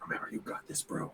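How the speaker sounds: secret whispered pep talk. Acted. Soren to Chet through his bracelet, whispered encouragement. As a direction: whisper it into a hidden communicator. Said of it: "pull up his bracelet and whisper".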